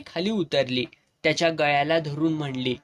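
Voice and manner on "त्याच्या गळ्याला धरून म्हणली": plain reading voice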